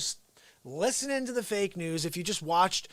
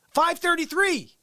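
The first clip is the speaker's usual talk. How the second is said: high, slow; 9 semitones higher, 3 dB louder, slower than usual. Real, not acted. Repeating his stream day count.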